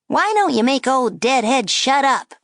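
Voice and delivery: high, loud wisecracking puppet; a brash ventriloquist dummy needling; cheeky dummy voice. A narrator's reading. Dummy mocking the girl at the table, high and brash. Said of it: "the dummy said to the man".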